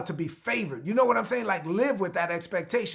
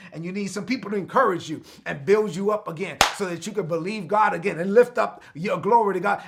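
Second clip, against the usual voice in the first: normal talking voice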